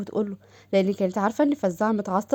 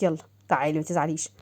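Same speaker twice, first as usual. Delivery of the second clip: coaxing; 6 semitones lower, faster than usual. Acted. Saqr coaxing her.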